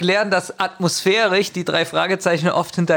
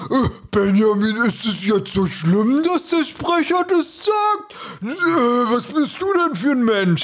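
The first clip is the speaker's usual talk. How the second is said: whiny, drawn-out mock of the co-host, higher at the end; mocking partner; 5 semitones higher than usual. Mocking how the co-host always complains about the narrator. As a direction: mimic a nagging complaint, slow and pitched up. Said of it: "sonst sagst du immer".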